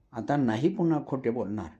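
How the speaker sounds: usual speaking voice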